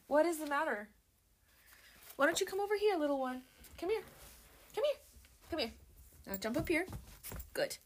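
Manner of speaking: high, sing-song voice coaxing a small pet; pet talk. Real, not acted. Talking to her pet.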